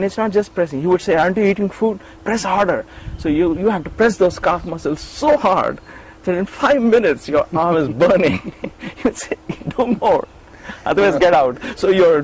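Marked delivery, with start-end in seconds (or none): 1.2-2.8 high-pitched, impatient chiding; mock scolding demand. Swami scolding the boy pressing his feet, demanding. 9.8-11.6 high, emphatic command; retold stern order. Swami ordering the tired attendant on, stern command.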